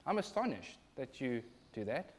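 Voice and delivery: a soft, polite rebuke; mild quiet astonishment. Shown as a demonstration. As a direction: say it gently, almost under the breath. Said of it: "So I can be astonished".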